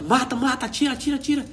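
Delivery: high frantic yelling. Retold or imitated. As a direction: yell the order from inside the tank. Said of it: "o cara gritando lá dentro do tanque".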